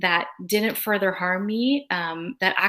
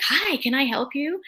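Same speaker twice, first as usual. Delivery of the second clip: much higher, bouncy eager-to-please greeting; eager young volunteer; 7 semitones higher than usual. Retold or imitated. Volunteer bounding over to a tired NICU mother, high and eager. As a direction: chirp it out like an overeager helper. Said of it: "bounds over to me and is like".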